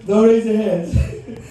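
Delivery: slow, drawn-out teasing warning; slow mock warning. Real, not acted. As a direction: draw it out like a playful threat. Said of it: "mock warning to audience".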